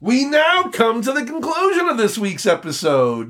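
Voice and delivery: host closing the show, in a hammed-up announcer voice; radio announcer. Mock radio sign-off.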